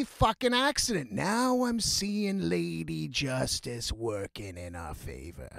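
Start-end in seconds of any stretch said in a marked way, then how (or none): 1.1-5.5 slow, low and softer dramatic narration; a mock-grand aside, slow and low; dramatic narrator. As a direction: intone it like fate speaking. Dramatic aside about fate.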